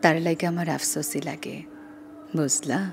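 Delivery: slow, aching recitation with long pauses; poem recitation. Shown as a demonstration. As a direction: recite as a lament for lost love. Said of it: "আমি সেটা একটু শোনাতে চাই".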